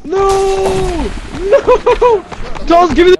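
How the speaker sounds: high, loud cry of no; panicked shout. Real, not acted. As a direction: yell it in horror.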